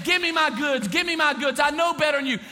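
loud, high arrogant demand; demanding son. Retold or imitated. Son demanding his inheritance, loud and arrogant. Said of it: "He went out saying".

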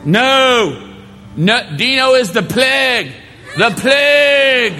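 high, loud, stretched cry of the plague; hammed-up shout; mock horrified refusal. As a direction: recoil like from a disease. Pastor voiced shouting refusal.

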